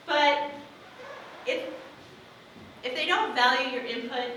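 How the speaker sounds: normal talking voice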